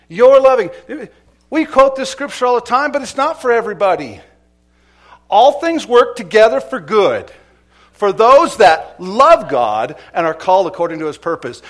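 loud, high, ringing preacher's call; sermon climax, preacher rousing the congregation, loud and high; full-force preaching. Real, not acted. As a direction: preach it out to the whole church.

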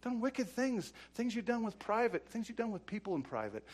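ordinary talk

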